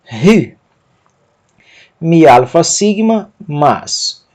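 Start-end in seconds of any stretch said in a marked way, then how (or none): 0.0-0.6 "ri" begun with an aspirated h; rough breathing. Shown as a demonstration. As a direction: say "ri" as a short "hy" with strong h. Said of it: "aspiração forte".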